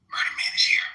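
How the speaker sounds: low whispered villain line; evil villain voice. Retold or imitated. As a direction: hiss it like a comic-book villain. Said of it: "evil villain voice".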